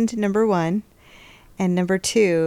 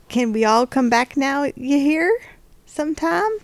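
put-on folksy Southern accent, pitched up at the ends; 5 semitones higher than usual. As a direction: sign off with a playful Southern accent. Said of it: "next time do a different accent".

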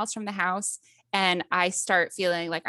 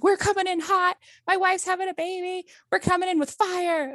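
much higher, hammed-up panicked husband; frantic husband; 10 semitones higher than usual. Husband calling the hospital while speeding, mock frantic. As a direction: play the husband wigging out on the phone. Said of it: "he's wigging out over there".

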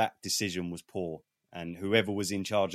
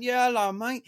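high, loud starstruck fan greeting; excited greeting; 6 dB louder than usual. Retold or imitated. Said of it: "I went".

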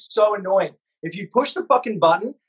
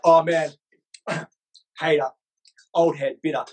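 lower, dismissive mock voice; lower mock critic; 6 semitones lower than usual. Mocking commenters who call him a hater, lower and dismissive. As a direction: sneer it like a commenter. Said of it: "people be like".

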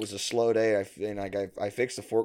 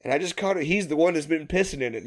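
ordinary delivery

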